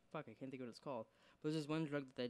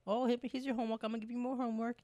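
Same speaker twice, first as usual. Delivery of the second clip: high, loud put-on teacher voice; mock teacher; 10 semitones higher, 6 dB louder, faster than usual. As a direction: hand out homework in a squeaky mocking voice. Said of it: "She's gonna be high school students".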